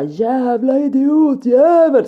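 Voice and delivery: high, loud, drawn-out yell; child's screaming. Retold or imitated. Mum imitates daughter screaming across the whole bay, screaming. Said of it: "så de skriker så här över hela viken".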